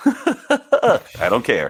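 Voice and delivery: slow, laughing dismissal. Real, not acted. Quiz host brushing off the contestant's no, laughing.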